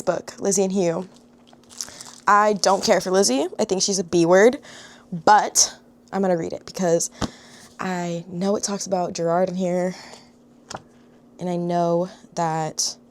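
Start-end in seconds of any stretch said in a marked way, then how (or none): none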